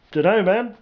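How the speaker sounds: a hint of a clueless grunt; laid-back soldier.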